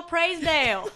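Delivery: put-on Southern accent. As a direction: ham up a Southern belle accent, pitched high. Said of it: "Let me get in my Southern persona".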